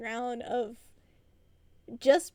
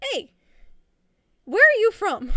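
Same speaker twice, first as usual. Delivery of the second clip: curious stranger; 8 semitones higher, 11 dB louder than usual. Retold or imitated. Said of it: "they'd be like".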